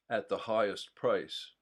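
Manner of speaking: usual speaking voice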